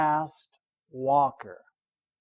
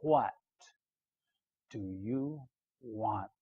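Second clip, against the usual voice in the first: quiet Socrates question; 6 semitones lower, 11 dB quieter than usual. A narrator's reading. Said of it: "He said".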